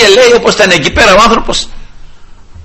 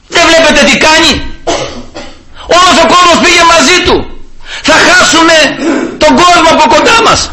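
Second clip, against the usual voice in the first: higher, alarmed voice of the jealous priests; worried Pharisees; 8 semitones higher than usual. Retold or imitated. Preacher voicing the Pharisees fearing lost followers, alarmed and higher. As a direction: sound like leaders afraid of losing their crowd.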